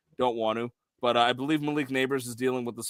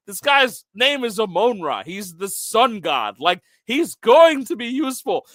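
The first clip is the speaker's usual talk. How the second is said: emphatic, higher-pitched and louder; insistent retelling; 7 semitones higher, 6 dB louder than usual. Retold or imitated. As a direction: argue your case to the doubters, punching it up. Retelling his argument with doubters.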